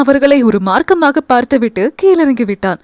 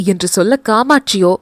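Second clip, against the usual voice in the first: ordinary delivery, nothing marked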